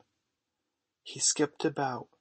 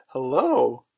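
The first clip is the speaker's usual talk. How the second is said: loud, startled hello; surprised; 10 dB louder than usual. A narrator's reading. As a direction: exclaim at an unexpected find. John startled by what he finds.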